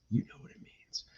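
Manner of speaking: hushed aside; whisper. Real, not acted. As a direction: whisper it knowingly. Whispered aside about Iowa.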